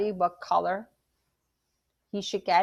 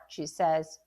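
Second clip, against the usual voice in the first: plain reading voice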